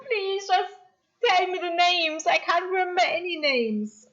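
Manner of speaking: high-pitched comic whine; exasperated pleading. Real, not acted. Streamer begging viewers for a forgotten name, whining. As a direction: plead helplessly, half laughing at yourself.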